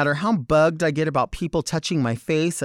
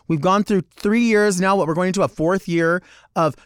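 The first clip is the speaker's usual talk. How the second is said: ordinary talk, nothing marked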